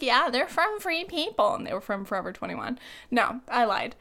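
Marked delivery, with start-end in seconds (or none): 0.0-1.5 higher, breezy fake-casual fib; playfully faking a straight answer; mock innocent claim. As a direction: pretend it's true, all innocence. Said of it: "And I was like".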